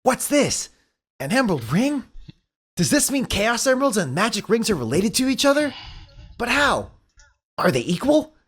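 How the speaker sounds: high, puzzled hero voice; curious Sonic. Acted. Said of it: "The Crocodile Ancient Walker".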